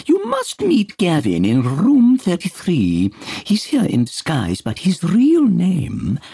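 very high, loud gushing hostess; eager hostess. Acted. Peter playing hostess to Dunbar, very high gushing. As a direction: coo like a delighted party hostess.

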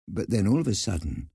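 ordinary delivery

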